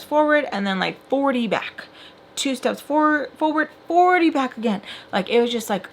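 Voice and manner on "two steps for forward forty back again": higher-pitched emphatic repeat; high, exasperated emphasis. Real, not acted. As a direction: repeat it in frustration, voice lifted. Repeats the phrase in frustration.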